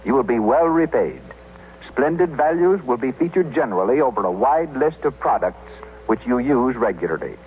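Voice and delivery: ordinary delivery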